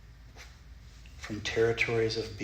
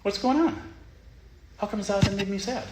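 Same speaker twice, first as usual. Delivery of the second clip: baffled; 8 semitones higher, 4 dB louder than usual. Retold or imitated. Puzzled student voiced.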